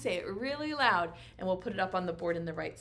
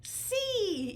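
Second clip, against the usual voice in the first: pretend child shout; 13 semitones higher than usual. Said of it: "Say it really loud".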